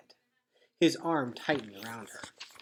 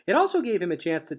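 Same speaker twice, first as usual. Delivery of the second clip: ordinary delivery, nothing marked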